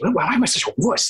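high-pitched mock self-scolding; arguing with himself. Actor scolding himself after chickening out, mock exasperated. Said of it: "I'm, like, arguing with myself".

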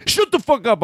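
high-pitched playful outburst; mock telling-off. Comedian telling off a dead friend for giggling, mock-angry outburst. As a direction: scold in pretend outrage, voice jumping up.

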